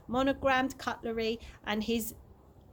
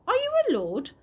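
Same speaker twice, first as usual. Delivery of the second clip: surprised; 12 semitones higher, 6 dB louder, faster than usual. A narrator's reading. Chloe stunned by discovery.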